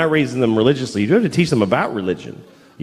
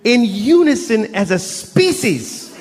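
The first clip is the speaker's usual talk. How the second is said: escalating comedy rant; 9 semitones higher than usual. Real, not acted. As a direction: build the rant to a peak.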